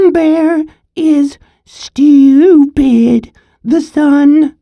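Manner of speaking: high, slow, mocking sing-song taunt; teasing. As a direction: taunt the bear like a bratty kid. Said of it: "began to tease Bear".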